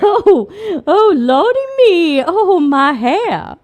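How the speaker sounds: a mock Southern belle fussing over her hair; hammed-up Southern belle accent. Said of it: "a dainty Southern accent for this".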